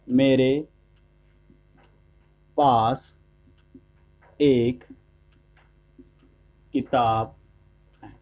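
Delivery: low, with long pauses between each word. Shown as a demonstration. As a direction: set each word apart for the class. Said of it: "मैंने बोला".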